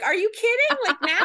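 high, loud mock disbelief; Facebook flagged an old meme: high incredulous outburst. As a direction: act stunned that it's happening now. Indignant quote to Facebook.

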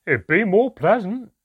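high, loud dwarf voice; jeering dwarf. A narrator's reading. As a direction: chime in with loud, high mockery. Another dwarf joins the jeering.

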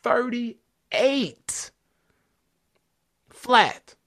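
excited. Real, not acted. Amazed at record speed run.

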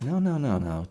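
high, rapid string of objections; mock-flustered parental protest; retold exasperation. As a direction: imitate a triggered parent cutting in. Parent reacting to dawdling child.

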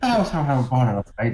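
lightly put-on Indian accent. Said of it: "I can't do an Indian accent".

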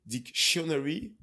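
dicTIOnary, weight on the second syllable; dictionary with "dic" swallowed and SHUN punched; wrong stress. Shown as a demonstration. Guest explains a mishearing, dictionary stressed on TION. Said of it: "English people, they will, uh, hear tionary".

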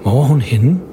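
usual speaking voice

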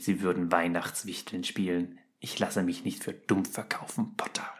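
low, soft and threatening whisper; menacing whisper. A narrator's reading. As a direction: hiss the threat close to his ear. Snape droht Harry. (drohendes Flüstern, tief und leise)

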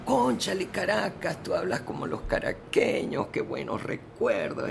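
famous writer charmed on the phone; García Márquez delighted. Retold or imitated. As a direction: gush nostalgically over the Caracas accent. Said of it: "se queda enganchado con mi acento venezolano".

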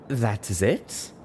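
slow, hesitant, rising query; cautious question. A narrator's reading. Zebra questions the pegasus's welcome, cautious. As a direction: ask it carefully, word by word. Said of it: "she asked cautiously".

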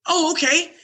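oh, that guy; sudden high recognition. Retold or imitated. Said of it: "agent realizes".

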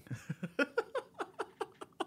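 high-pitched laugh at himself; embarrassed real laugh. Real, not acted. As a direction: break up laughing. Laughs at his own cheesy line.

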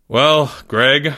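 teacher's mildly resigned opening; a touch of a sigh in it. A narrator's reading. Teacher wrapping up with a student, sighing. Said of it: "he said with a sigh".

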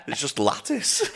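giggling out the word "lattice"; laughing. Real, not acted. To his co-host, laughing at himself, giggling.

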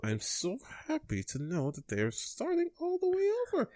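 high, soft, forced happiness; small high voice, badly faking joy; unconvincingly happy. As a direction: claim delight while sounding anything but happy. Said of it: "You don't sound happy, though".

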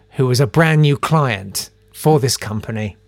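usual speaking voice

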